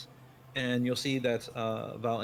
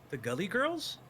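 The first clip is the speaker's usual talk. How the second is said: surprised; 8 semitones higher than usual. Acted. NPC surprised at the names.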